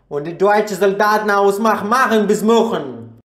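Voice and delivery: loud, high fake German gibberish; mock German. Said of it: "Or should I say in your language?".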